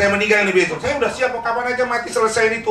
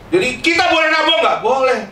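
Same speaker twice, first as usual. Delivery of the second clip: much higher and louder, calling out to the room; lively loud question; 10 semitones higher, 5 dB louder than usual. Real, not acted. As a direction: ask the hall with energy. Asks congregation, answers himself. (bertanya lantang penuh semangat)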